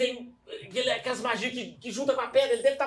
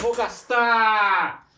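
slow, drawn-out and louder; triumphant declaration; 8 dB louder, much slower than usual. Real, not acted. Player declares he spends his hero point, triumphant. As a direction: commit to it with a big flourish. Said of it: "declaring hero point".

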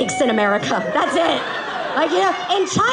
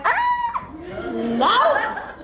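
high squeal into a raised "no"; high acted squeal; 11 semitones higher than usual. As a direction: squeal high, then push out the no. Acting out her reaction to him.

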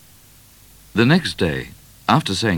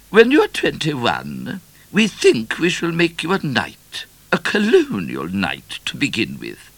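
regal queen voice; 8 semitones higher than usual. A narrator's reading. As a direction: speak as a haughty queen. Queen speaks.

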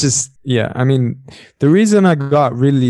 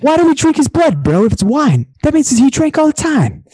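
high, loud bro logic; over-the-top impression of an indignant bro; incredulous party dude; 13 semitones higher, 3 dB louder than usual. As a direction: imitate a dude arguing in mock outrage. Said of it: "And he's like".

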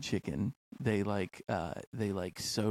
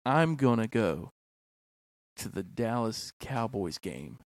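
slow dictation; slower than usual. Shown as a demonstration. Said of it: "I need you to say".

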